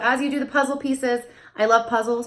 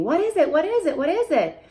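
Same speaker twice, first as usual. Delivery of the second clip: high insistent repeated question; high repeated parentese question; repeated nagging question; 7 semitones higher than usual. Shown as a demonstration. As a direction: keep asking over and over at the child. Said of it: "rather than waiting it out and repeating".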